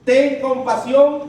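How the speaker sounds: high, loud, slow plea; pleading cry. Acted. Blind man begging Jesus for mercy, slow loud plea. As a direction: stretch out a begging shout. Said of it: "Otro término importante".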